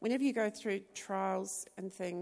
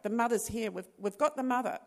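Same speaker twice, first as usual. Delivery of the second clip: loud, raised call to the room; retold yell; 4 semitones higher, 8 dB louder than usual. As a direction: call out an urgent announcement, as a nurse would. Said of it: "this nurse yelled out".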